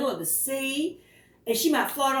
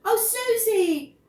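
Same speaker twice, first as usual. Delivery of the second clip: proud praise; 12 semitones higher than usual. Acted. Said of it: "said Eleanor".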